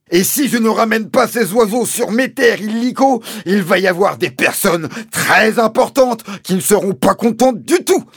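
high, loud, emphatic tirade; Mister Hazel threatening. A narrator's reading. Landowner threatening the sergeant with his boss, high angry hectoring. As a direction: jab each threat out in a shout. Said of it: "s'écria mister Hazel, en donnant de petits coups".

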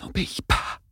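unvoiced whisper. Arlène warns Dale in a whisper. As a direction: breathe the warning, no voice. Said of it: "chuchota Arlène".

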